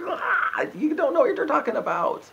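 very high, loud disgusted cry; exasperated outburst. Retold or imitated. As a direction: burst out high in revulsion, mocking. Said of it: "'cause I'm just like".

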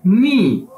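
नी drawn out slowly and clearly; slow, stretched demo of नी; Chinese word pronounced. Shown as a demonstration. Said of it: "इसकी pronunciation". (नी को धीरे और खींचकर बोलें)

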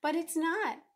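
put-on upset child's confession; crying out. A narrator's reading. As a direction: admit it in mild distress. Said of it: "cried Ruthie".

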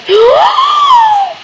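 sharp high gasp of delight; gasp, thrilled and loud; a squealing gasp of joy; excited gasp. Real, not acted. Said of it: "I got so excited over that".

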